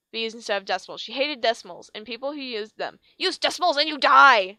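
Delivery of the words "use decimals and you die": loud shouted threat; teacher screams at Blue; screamed threat. A narrator's reading. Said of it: "she screamed".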